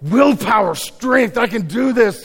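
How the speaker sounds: a touch of a pumped-up pep talk to yourself; mock determined rallying cry; self pep talk. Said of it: "There are other temptations where I'm like".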